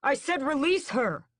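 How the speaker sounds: high, loud angry demand; furious loud challenge; cried out. A narrator's reading. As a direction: cry out at the guards. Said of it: "Raoul cries out".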